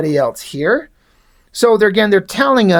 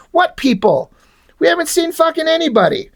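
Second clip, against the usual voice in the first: exasperated outburst; 8 semitones higher than usual. Real, not acted. Frustrated at the unseen people.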